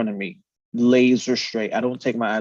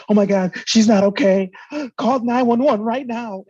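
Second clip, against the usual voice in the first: high, loud, frantic cry to call for help; panicked bystander; 11 semitones higher, 5 dB louder than usual. Retold or imitated. Bystander yelling at the driver to call nine one one, high and panicked. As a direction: gasp and shriek it like a terrified witness. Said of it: "He's like".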